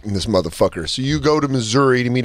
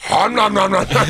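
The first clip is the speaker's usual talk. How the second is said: mock eating noises; 6 semitones higher than usual. Mocking her eating.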